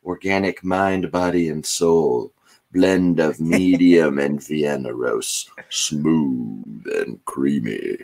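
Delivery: slow smooth radio announcer read. Pretend. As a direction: read it like a silky coffee ad. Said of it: "you do have the radio voice".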